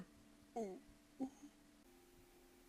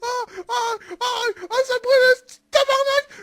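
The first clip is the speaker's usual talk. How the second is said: pained cry; 7 semitones higher, 6 dB louder than usual. Real, not acted. Crying out in pain.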